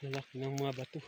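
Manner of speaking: usual speaking voice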